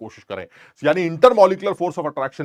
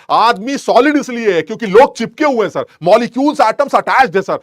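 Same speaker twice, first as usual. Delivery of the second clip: higher and louder, driving the point home; emphatic excited burst; 7 semitones higher, 10 dB louder than usual. Real, not acted. Teacher hammering solids to students, loud and excited. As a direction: push it hard like it's obvious.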